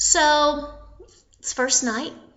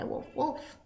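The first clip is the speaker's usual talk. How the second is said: very soft, whispered wolf; barely voiced musing; hushed trial cry; 14 dB quieter than usual. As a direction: try the word out under your breath. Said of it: "what happens if I cry".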